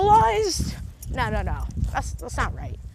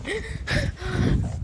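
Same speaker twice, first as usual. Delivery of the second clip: laugh; 7 semitones higher than usual. Real, not acted. Laughs at own slip.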